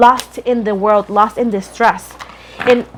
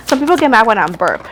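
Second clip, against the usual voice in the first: ordinary talk, nothing marked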